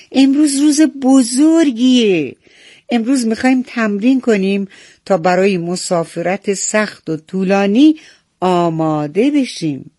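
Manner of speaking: high, loud announcement easing toward the end; proclaiming herald. A narrator's reading. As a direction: proclaim the big day to the whole flock. Herald proclaims after blowing horn.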